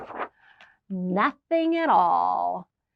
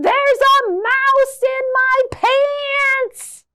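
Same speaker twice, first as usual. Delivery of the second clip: excited shout; 9 dB louder, much slower than usual. Acted. Scarecrow finally speaks.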